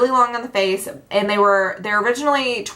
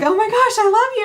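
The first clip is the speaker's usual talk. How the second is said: much higher and louder, squealing with delight; excited squeal; 14 semitones higher, 4 dB louder than usual. Retold or imitated. Fan meeting her YouTube idol, excited squeal. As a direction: gush like a starstruck fan.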